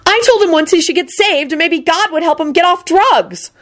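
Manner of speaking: high, aggrieved character voice; angry spluttering. A narrator's reading. Woman defends her efforts to save him, angrily. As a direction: protest it all on a high indignant pitch. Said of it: "Shala spluttered out angrily".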